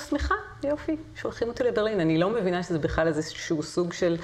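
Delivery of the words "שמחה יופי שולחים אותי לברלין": naive delight. Retold or imitated. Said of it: "ואני כאילו".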